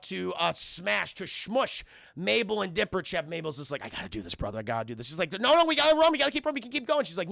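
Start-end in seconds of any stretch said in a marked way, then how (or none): 3.9-5.1 low, soft retold resolve; grave resolve. Mabel tells her brother she must do it, quietly resolved. 5.3-7.0 high, urgent mock pleading; frantic urging. Dipper begs his sister to keep running, mock frantic.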